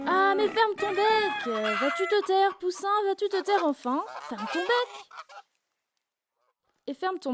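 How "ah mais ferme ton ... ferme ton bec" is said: high, loud scolding yell; shouting chorus. A narrator's reading. All the animals yelling at the chick, high and loud. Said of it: "se mettent à crier".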